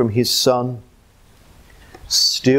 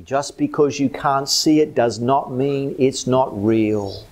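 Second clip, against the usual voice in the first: normal talking voice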